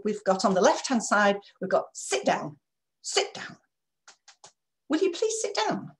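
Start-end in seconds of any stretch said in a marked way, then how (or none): 1.9-3.6 curt hushed command; aggressive order. Shown as a demonstration. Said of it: "because that's aggressive". 4.9-5.9 much higher, strained please; fed up with asking again; exasperated plea. Shown as a demonstration. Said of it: "slightly disappointed".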